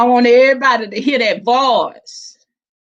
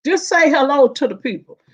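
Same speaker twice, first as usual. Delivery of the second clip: normal talking voice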